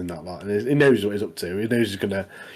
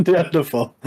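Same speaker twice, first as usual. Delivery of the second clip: higher-pitched, amused echo through laughter; laughing; 5 semitones higher than usual. Real, not acted. Co-host cracking up at his friend's insult, laughing. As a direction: laugh while repeating the word.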